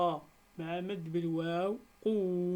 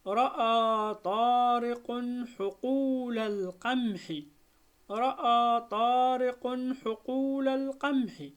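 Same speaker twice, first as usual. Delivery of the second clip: higher, louder careful reading voice; model reading aloud; 4 semitones higher, 5 dB louder than usual. Shown as a demonstration. Teacher to pupils, model sentence reading, high and loud. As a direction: read it out clearly for the class. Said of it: "نمر الآن إلى قراءة الجمل".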